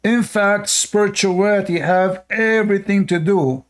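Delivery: hammed-up excited preacher; mocking excited preacher. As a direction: mimic his excited, jumping tone. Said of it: "look how he's excited and jumping".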